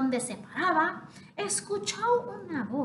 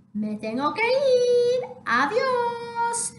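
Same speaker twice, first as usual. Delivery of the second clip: high, louder sing-song goodbye; hurried little chick; 9 semitones higher, 3 dB louder than usual. Acted. Half chick dismisses the fire, high sing-song. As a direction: skip off chanting tipitap.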